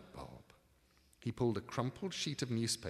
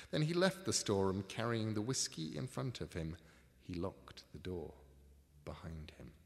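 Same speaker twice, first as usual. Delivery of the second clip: ordinary delivery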